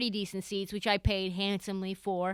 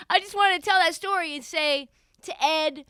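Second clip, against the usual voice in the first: usual speaking voice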